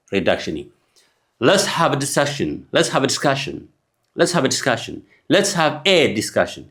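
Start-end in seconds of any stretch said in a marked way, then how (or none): 1.4-6.6 slow demo, "a" in "discussion" sentence said as a light uh; reduced a, slowly. Shown as a demonstration. English teacher drilling the reduced "a" for students. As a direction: glide the "a" into a weak schwa. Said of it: "ድምፂ".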